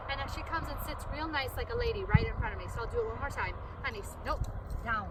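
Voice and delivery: ordinary delivery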